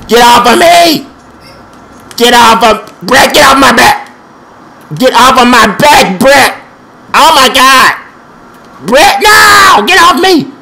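high, loud frantic yelling; desperate shouting. Real, not acted. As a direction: shout him off you in a panic. Opponent attacking him in game.

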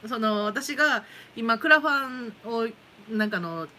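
ordinary talk

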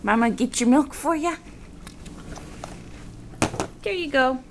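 ordinary delivery

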